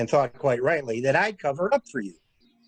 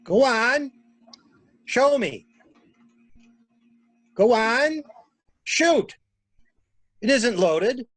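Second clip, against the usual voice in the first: high taunting urging; 7 semitones higher, slower than usual. Acted. Taunting her to shoot.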